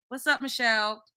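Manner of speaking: usual speaking voice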